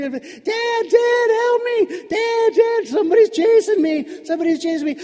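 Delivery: high, loud panicked yell; screaming for help. Acted. Child being chased screams to Dad, panicked screaming. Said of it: "so I started screaming".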